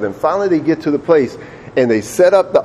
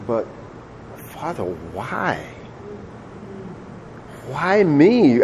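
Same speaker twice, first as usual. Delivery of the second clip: slow hurt plea, opening very soft; Isaac crying; 2 semitones higher, 6 dB quieter, much slower than usual. Acted. Isaac to his father on being the sacrifice, tearful plea. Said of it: "Isaac's crying".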